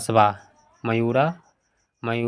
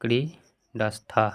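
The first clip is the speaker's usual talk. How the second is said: slow dictation; much slower than usual. Shown as a demonstration. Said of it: "तो यहाँ पे क्या हो जाएगा?".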